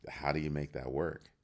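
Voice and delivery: ordinary talk, nothing marked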